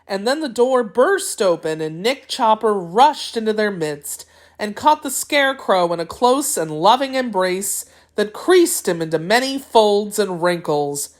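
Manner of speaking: ordinary delivery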